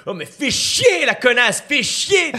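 a hammed-up angry French accent, high and loud. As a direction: shriek it like an enraged young Frenchwoman swearing. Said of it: "Tu fais vachement bien l'accent en colère".

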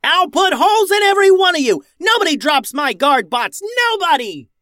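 very high, loud battle shout; furious yell. A narrator's reading. Captain avenges fallen guard bot, furious yell. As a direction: roar threats at the enemy. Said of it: "yelled Max".

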